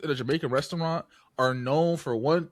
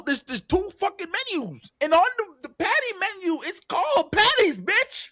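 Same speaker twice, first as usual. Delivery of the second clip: high, loud, worked-up rant; heated high yell about the menu; real yelling; 12 semitones higher, 3 dB louder than usual. Real, not acted. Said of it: "I'm, I'm yelling".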